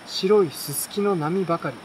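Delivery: ordinary delivery, nothing marked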